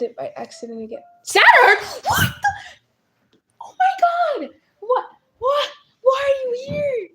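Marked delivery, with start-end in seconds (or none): 1.2-7.1 high, loud stunned disbelief; real shocked squeal at a famous viewer in chat. Real, not acted. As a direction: shriek at a surprise guest.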